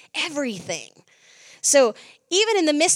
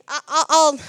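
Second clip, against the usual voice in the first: usual speaking voice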